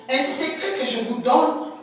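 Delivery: normal talking voice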